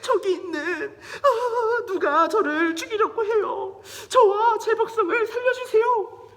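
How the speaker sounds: high, loud, wailing plea; hammed-up weeping queen; tearful pleading. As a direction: beg for your life through acted sobs. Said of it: "에스더가 거기서 막 우는 거예요".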